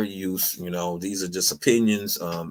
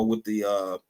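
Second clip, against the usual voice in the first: ordinary talk, nothing marked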